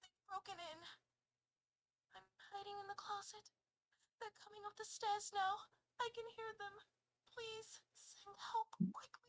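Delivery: high, hushed frightened plea; soft high panic, slowing into a whisper; really scared. Acted. Auditioning as caller hiding from intruder, really scared. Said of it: "you're really, really scared".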